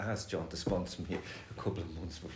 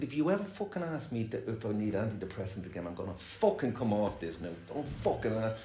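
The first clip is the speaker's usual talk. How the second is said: retold anger; 5 semitones higher, 4 dB louder than usual. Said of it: "I was getting really angry".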